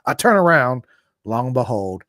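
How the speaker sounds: ordinary talk, nothing marked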